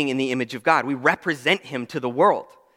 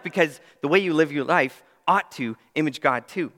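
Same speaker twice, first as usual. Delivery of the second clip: ordinary talk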